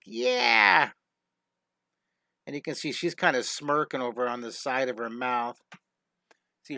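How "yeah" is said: a cocky little higher "yeah"; smug character. Acted. Masked girl Anvil smirking at the viewer, cocky voice. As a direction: toss it off like a smirking bandit. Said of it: "She's like".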